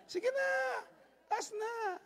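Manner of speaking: high, pleading sing-song coax; high coaxing plea. Acted. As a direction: wheedle them into raising hands. Coaxing audience to raise hands. (mataas at nagsusumamong paghikayat)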